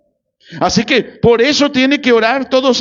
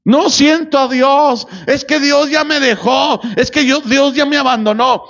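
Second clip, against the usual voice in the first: high, childish mock lament; niño espiritual quejoso; 5 semitones higher than usual. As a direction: imitate a spiritual child complaining of being abandoned. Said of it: "Es un niño espiritual". (lamento infantil fingido, en tono agudo)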